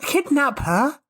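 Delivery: ordinary delivery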